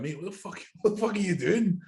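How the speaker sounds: high, strained mock outrage climbing to a squeal; mock outraged yell. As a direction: act out a coach losing it at his client. Coach scolding friend's training.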